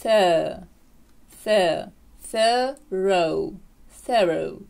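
put-on thorough built from th plus girl vowel, ending in oh; THUR-oh, sounded out piece by piece for thorough; American pronunciation. Shown as a demonstration. Teacher assembles the American variant, sounded-out pronunciation. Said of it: "як в слові girl".

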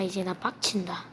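unvoiced seething whisper; angry whisper. Real, not acted. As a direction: whisper your rage. Angry at enemy. (화난 속삭임)

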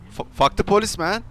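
ordinary delivery

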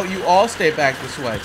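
usual speaking voice

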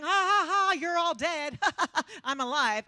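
loud, high mock laugh; gloating laughter. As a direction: cackle in triumph over the doubters. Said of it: "And he's laughing".

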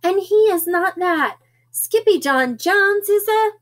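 high, insistent frazzled mother. A narrator's reading. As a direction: insist at the end of your patience.

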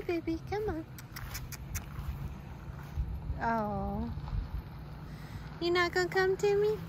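high, soft coaxing call to a scared dog. Real, not acted. Woman coaxing scared stray dog in parking lot, high soft call. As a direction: gently lure the stray dog closer.

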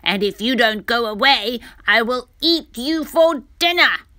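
loud, menacing crocodile threat; growling crocodile. A narrator's reading. Crocodile threatening to eat Dudu, loud and menacing. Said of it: "growled the crocodile".

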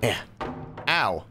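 normal talking voice

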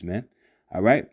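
normal talking voice

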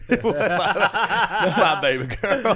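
high, loud Southern imitation through laughter; mock Southern accent. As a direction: laughingly parody the Louisiana accent. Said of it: "do a little Blue Bayou audition".

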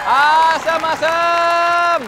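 calling out. Acted. Vendor crying sour fruit.

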